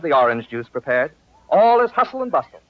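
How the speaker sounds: usual speaking voice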